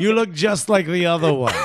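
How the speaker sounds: snooty Manhattanite. Retold or imitated. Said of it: "the Manhattan brush".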